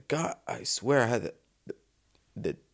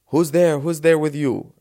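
mock demand; 5 semitones higher, 7 dB louder than usual. Driver shouting to police.

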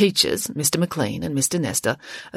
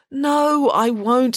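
yawning; 8 semitones higher, 4 dB louder, much slower than usual. Acted. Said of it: "said Friday with a yawn".

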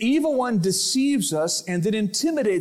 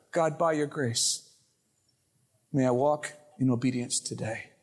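quiet prayer; 7 semitones lower, 4 dB quieter than usual. Shown as a demonstration. Better prayer modeled.

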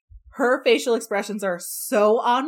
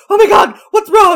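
loud, high frantic cry; hammed-up panic over nothing; panicked; 12 semitones higher, 15 dB louder than usual. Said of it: "she's like".

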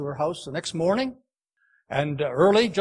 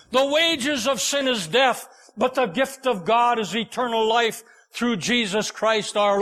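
higher, impassioned sermon delivery; preacher reciting verses to the congregation, impassioned; preaching; 3 semitones higher than usual. Acted. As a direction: build the scripture like a pulpit climax.

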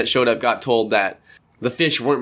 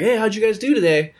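cheery warden asking; 8 semitones higher than usual. Retold or imitated. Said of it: "Guys come back".

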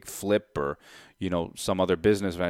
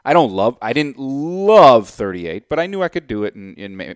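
ordinary delivery

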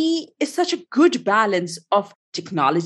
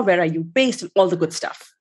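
ordinary talk, nothing marked

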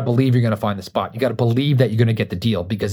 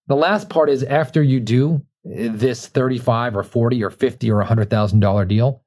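ordinary talk, nothing marked

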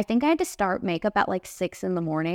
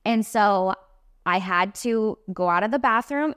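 ordinary talk

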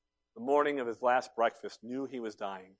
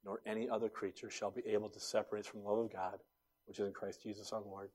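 very soft, low scripture quoting; hushed recitation; 4 semitones lower, 9 dB quieter than usual. Retold or imitated. Dying pastor quoting Romans from memory, hushed. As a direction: recite it quietly, as from a deathbed.

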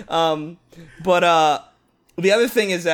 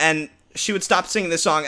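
usual speaking voice

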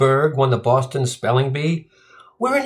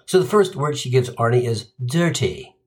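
normal talking voice

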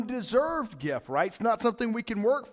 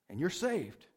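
softer, lower glib reassurance; mock easy promise; 5 semitones lower, 7 dB quieter, slower than usual. Pastor parodying easy salvation, soft glib promise. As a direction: hush it like a cheap pitch. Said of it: "Let me see if this sounds familiar".

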